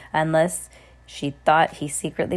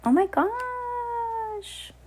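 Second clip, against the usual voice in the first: high, very slow drawn-out gasp of wonder; amazed; 4 dB quieter, much slower than usual. Real, not acted. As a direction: marvel at the picture. Reacts to picture page.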